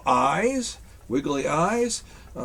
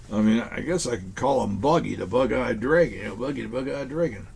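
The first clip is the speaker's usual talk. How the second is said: ordinary delivery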